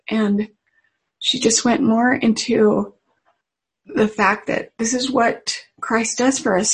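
ordinary talk, nothing marked

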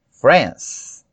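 "France" in one syllable, closing on S; one-syllable English pronunciation. Shown as a demonstration. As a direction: end "France" on the S without a vowel. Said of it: "ela é monossílaba".

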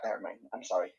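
whispered apology; whispering. Real, not acted. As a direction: whisper it sheepishly. Apologizing after a mistake.

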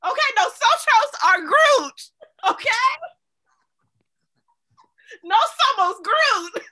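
loud, high, slow declaration; dramatic Spanish line. Retold or imitated. Retelling the Spanish dub moment, loud and high. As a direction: proclaim it like a movie climax.

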